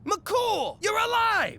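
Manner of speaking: high, joyful cry; overjoyed at seeing him alive; excited shout. Acted. Friend thought dead wakes up.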